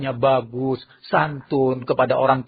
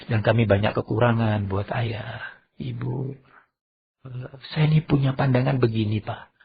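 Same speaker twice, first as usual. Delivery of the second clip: polite son; 5 semitones lower, 4 dB quieter than usual. Acted. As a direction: offer advice gently and respectfully. Good son politely advises father.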